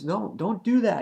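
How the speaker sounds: high, pleading mock protest; urgent protest. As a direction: imitate someone urgently objecting. Retold protest mid-battle.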